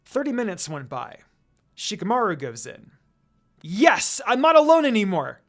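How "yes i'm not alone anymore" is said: loud, high, relieved shout; excited yell. A narrator's reading. Lucy yelling with relief in an empty classroom. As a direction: shout it out, glad not to be alone. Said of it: "Lucy yells".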